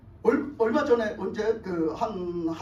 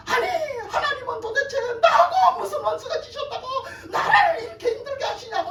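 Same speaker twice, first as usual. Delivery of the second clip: high, loud imitated outcry; resentful railing; 13 semitones higher, 5 dB louder than usual. Upset church member blaming God to her pastor, imitated resentful shouting. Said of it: "원망을 하기 시작하는데요".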